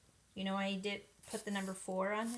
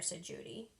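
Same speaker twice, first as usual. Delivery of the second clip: plain reading voice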